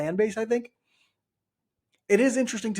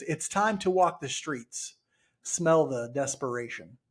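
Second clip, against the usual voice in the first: slow, drawn-out spoken lyric line; slow recited lyric; 3 semitones lower, 4 dB quieter, slower than usual. Retold or imitated. To a co-host, slowly quoting the song line. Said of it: "One of the lines in the song is".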